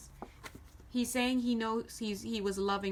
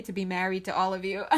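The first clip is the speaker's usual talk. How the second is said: ordinary talk, nothing marked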